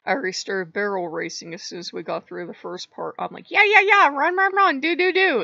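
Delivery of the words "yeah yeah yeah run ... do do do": high, loud, excited chanting; hyped-up rushing. Retold or imitated. Player barrel racing through the game, hyped-up yelling. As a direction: whoop it out like a racer.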